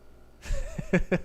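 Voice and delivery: high yippy puppy whimper; squeaky little puppy noise; puppy impression. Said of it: "that's my impression of a puppy".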